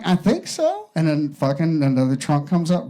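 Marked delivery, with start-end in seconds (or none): 0.1-0.9 much higher, softer, unsure; a small high shrug of a reply; sheepish husband. Acted. Husband answering his thrilled wife, high sheepish voice. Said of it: "And he's like".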